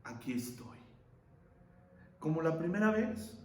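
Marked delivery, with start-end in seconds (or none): 0.0-0.8 quiet answer. Acted. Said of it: "sabes cómo respondió él".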